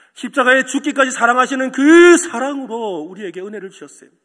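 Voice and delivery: usual speaking voice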